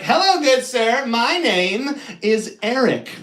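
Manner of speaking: hammed-up wizard greeting; pompous wizard. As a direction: intone it like a self-important wizard. Wizard voiced in story.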